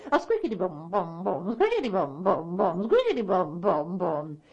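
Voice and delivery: rhythmic squeak, then dropping booms; weaving loom noise. Acted. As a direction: imitate a shuttle clacking back and forth. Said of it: "she was also weaving".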